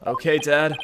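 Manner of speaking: ordinary talk, nothing marked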